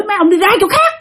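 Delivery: high, scolding shout; angry chasing off. Retold or imitated. Dreamer scolding ghost soldiers off the roof, high and angry. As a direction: drive the soldiers away with a high yell. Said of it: "Thi mới chửi".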